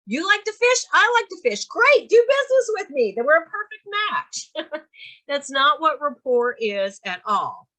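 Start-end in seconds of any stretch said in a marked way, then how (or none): 0.1-4.8 high-pitched, overexcited mock bonding; eager mock enthusiast. Trainer mocking bonding over fishing, overeager. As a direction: gush with exaggerated eagerness to match. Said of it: "It's not like".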